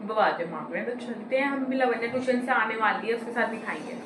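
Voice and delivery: usual speaking voice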